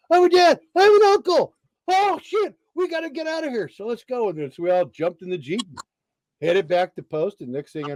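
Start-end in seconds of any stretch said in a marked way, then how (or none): 0.1-1.5 high, loud whooping announcement; excited yelling. Retold or imitated. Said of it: "he starts yelling". 1.9-4.3 very high voice, dropping in volume; excited urgency. Retold or imitated. Acts out their frantic rush.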